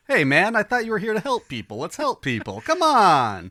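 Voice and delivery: loud, high New Jersey guy; New Jersey accent. Retold or imitated. Podcaster mimics Pike as a New Jersey guy pleading, loud. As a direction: pitch it up like a Jersey dude pleading. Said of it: "New Jersey all of a sudden".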